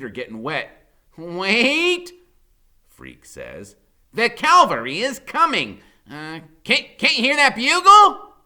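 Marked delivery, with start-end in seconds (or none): 1.2-2.1 urgent call. Acted. Freak urgently calling. 4.1-8.3 excited announcement. Acted. Freak excitedly announcing rescue.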